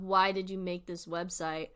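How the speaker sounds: normal talking voice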